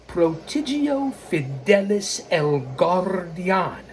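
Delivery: slow Spanish-style delivery with rolled r; Spanish-accented flourish. Shown as a demonstration. Host proudly announces his heritage account, slow Spanish accent. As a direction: draw the name out in a Spanish accent.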